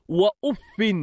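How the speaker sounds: وأف said "wa-uffin", ending in an n; genitive tanwin. Shown as a demonstration. Teacher showing students وأف as "wa-uffin", with tanwin. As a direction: end وأف on "-fin". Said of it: "بالخفض والتنوين".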